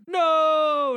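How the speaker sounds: loud, high, drawn-out "no"; hammed-up anguished yell; dramatic movie cry. Buzz learning who his father is, hammed-up cry. Reenacting reaction to father reveal.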